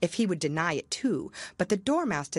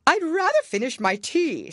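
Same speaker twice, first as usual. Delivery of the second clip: anxious Hatter; 11 semitones higher, 7 dB louder than usual. Acted. Hatter voiced.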